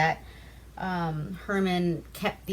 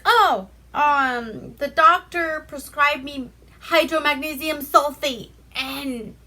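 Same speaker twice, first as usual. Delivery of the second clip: high, fussy put-on voice listing her cures; hypochondriac aunt; 7 semitones higher, 7 dB louder than usual. Hypochondriac aunt tells the pair her prescription, high fussy voice. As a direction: play the fretful aunt proud of her medicine. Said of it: "'cause she's a hypochondriac".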